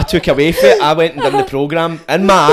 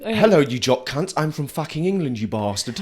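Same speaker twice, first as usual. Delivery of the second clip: English accent; 6 dB quieter than usual. Shown as a demonstration. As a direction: do your best put-on English accent. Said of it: "Let's hear your best English accent".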